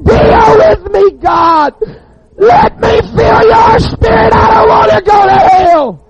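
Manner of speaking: desperate crying scream, very high and loud; a man hanging over death, howling to God. Retold or imitated.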